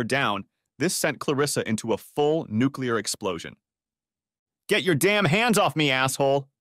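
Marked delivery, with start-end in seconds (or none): 4.7-6.5 raised-pitch, indignant outburst; an understated scream of outrage; mild narrated scream. A narrator's reading. Wife batting deputy's hand away, mildly screamed. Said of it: "she screamed".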